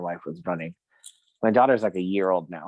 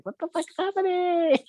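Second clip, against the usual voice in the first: imitating a shocked wife, much higher. Wife seeing the bloody sword, high mock alarm. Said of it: "My wife is like".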